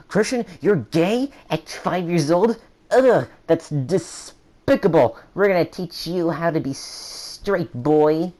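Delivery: high, loud, slow scandalized parent; disgusted parent. Retold or imitated. Imagined parents scolding their son, high, slow and disgusted. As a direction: sneer it like an outraged parent. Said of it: "my parents would have thought".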